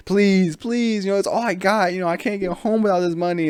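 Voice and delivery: high, pleading mock beg; begging. Tourist begging the pigeon gang for his money, high mock pleading. Victim begs pigeon muggers.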